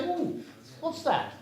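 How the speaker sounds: high, curious mock exclamation; surprised visitor. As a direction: play an intrigued guest. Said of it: "Anybody coming in to visit you and say".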